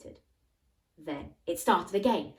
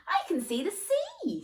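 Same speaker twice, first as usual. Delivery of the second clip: excited; 14 semitones higher than usual. Acted. Mum spots the sea excitedly.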